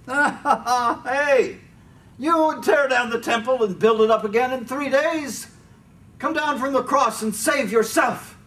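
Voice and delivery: high, loud taunting with laughter; passersby wag their heads and taunt, high mocking jeer; jeering crowd. A narrator's reading. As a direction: taunt him from the roadside, loud and scornful. Passersby jeer at the crucified man.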